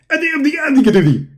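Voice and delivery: high mock parroting of the opponent's garble; garbled mockery. Ridiculing the caller's speech with high, mock parroting. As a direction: echo his words as silly nonsense. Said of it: "is that a Bangladesh language or something".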